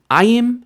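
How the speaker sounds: AY-im, high punch on "ay"; stress on first syllable. Shown as a demonstration. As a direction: hit the "ay" of ayim. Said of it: "when you have that ayim ending, it's gonna be".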